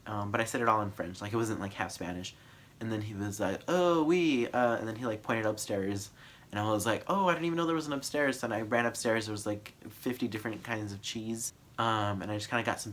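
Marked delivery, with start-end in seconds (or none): 3.7-4.5 understanding. Retold or imitated. Clerk finally understands. 7.1-8.4 surprised. Retold or imitated. Surprised to learn of upstairs.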